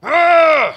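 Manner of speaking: loud high groan of frustration; frustrated yell. Retold or imitated. Mad at God in the garage, loud frustrated yell. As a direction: let out a big angry ugh. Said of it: "go out into the garage and go".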